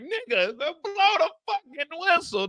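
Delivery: high, slow, strained croak; strangled plea. Retold or imitated. Mo begging the ref near blacking out, high strained croak. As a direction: beg with the last bit of air. Last breath while choked.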